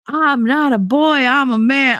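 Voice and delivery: lightly put-on indignant coworker voice; mimicking Marty. Retelling Marty's protest at work, mild mock indignation.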